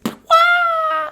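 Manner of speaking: very high squealing wail; squeaky falling wail; high cartoon wail. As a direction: let out a tiny cartoon shriek. Scream as star falls.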